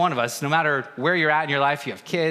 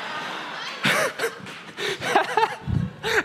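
high laugh. Real, not acted. Laughing at son's retort.

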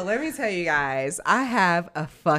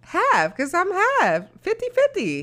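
high excited insistence; 6 dB louder than usual. Real, not acted. Insists she is half.

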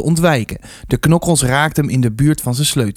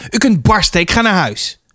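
high, angry, tearful defiance; shouting; 7 semitones higher than usual. A narrator's reading. Arnold breaking free from the rector, defiant outcry. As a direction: cry it out in defiance, pitched high. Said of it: "schreeuwde hij".

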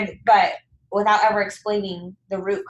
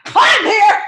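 much higher and much louder, shouted; triumphant shout; 13 semitones higher, 11 dB louder than usual. Acted. Host acts out breaking through the ice, triumphant shout. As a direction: burst up out of the water, yelling. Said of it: "you're like".

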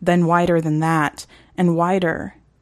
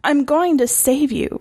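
higher, earnest promise; reassuring girl; 9 semitones higher than usual. Acted. Reagan promising Eric, earnest and higher.

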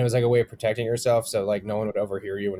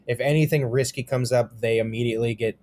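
ordinary talk

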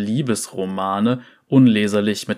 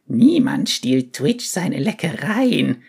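crazed rat voice; 4 semitones higher, slower than usual. Retold or imitated. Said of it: "kicherte wie irre".

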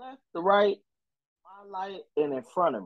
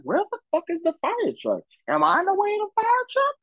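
much higher, faster mock panic; retold alarm. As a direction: replay the moment of confused alarm, pitched way up. Retold panic at hearing a siren.

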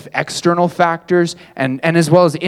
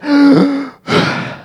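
gasp; 10 semitones higher than usual. Retold or imitated. Retelling running out of breath.

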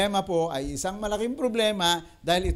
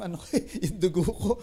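laughing; faster than usual. Real, not acted. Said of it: "laughs at own story".

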